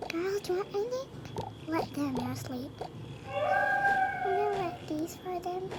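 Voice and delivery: lightly voiced soft mother; gentle mother. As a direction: mom quietly tending the girls. Mother character to girls, softly.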